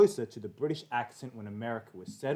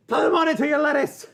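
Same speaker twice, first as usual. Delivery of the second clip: high, very loud mock-Irish yell; screamed Irish accent; 14 semitones higher, 9 dB louder than usual. Co-host imitating an Irish YouTuber's stream opening, screamed Irish accent. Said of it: "he screams it".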